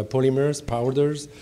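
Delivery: normal talking voice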